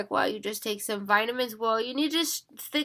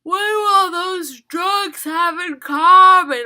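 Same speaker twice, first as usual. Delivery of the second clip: shout; 9 semitones higher, 11 dB louder, slower than usual. Real, not acted. Rhetorical outburst.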